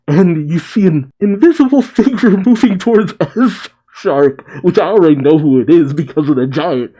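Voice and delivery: high, loud and breaking into laughter; amused, voice lifted by giggles; laughing while narrating. Real, not acted. Amused at obvious mystery figure.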